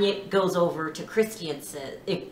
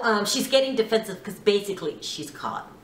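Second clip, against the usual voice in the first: normal talking voice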